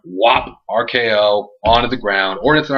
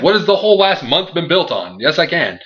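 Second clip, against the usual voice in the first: heated protest; 7 semitones higher than usual. Retold or imitated. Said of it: "Randy Orton's like".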